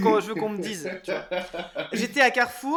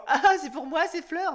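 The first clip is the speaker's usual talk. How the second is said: high-pitched mock delight; imitated jokey passer-by; 7 semitones higher, 3 dB louder than usual. Host imitating people he passes with flowers, mock delight. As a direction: parody a tired joke with fake glee.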